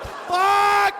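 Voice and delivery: high, loud yelp of panic; panicked curse. Retold or imitated. Dad spotting the exhaust pipe at his baby's face, loud panicked yelp. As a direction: blurt it out in sudden horror. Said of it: "I'm like".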